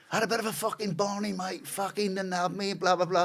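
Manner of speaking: high, matey inmate voice; imitated London prisoner. Brawler explaining his fucking barney to the cells, imitated inmate voice.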